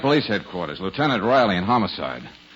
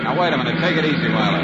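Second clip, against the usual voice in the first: raised alarm; 5 semitones higher, 6 dB louder than usual. Acted. Speeding car, pleading with her.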